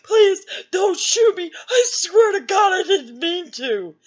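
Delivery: put-on crying scared teen; begging, crying. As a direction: beg for your life through tears. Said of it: "And I'm just like".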